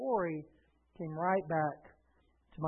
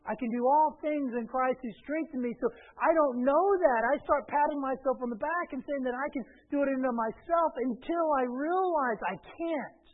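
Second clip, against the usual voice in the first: loud, raised preacher voice; full-force preaching; 8 semitones higher, 8 dB louder than usual. Real, not acted. Pastor warning against self-congratulation, forceful preaching. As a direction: drive the confession home.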